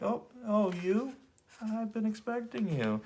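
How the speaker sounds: lightly voiced cool hero; smooth, unbothered greeting; silky polite villain. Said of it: "trying to play it all cool".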